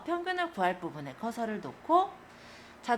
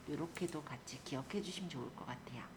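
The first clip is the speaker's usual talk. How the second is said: whispered aside to the viewers; soft whisper; 4 semitones lower, 9 dB quieter, faster than usual. Real, not acted. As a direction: drop to a breathy whisper for the tip. Soft closing tip. (속삭이듯 조용히)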